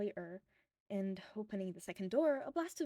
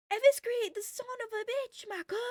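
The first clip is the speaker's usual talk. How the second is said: very high, loud yell; fan screaming; 14 semitones higher, 7 dB louder than usual. A narrator's reading. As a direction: shriek it from the back of the crowd. Third fan yelling at the fight.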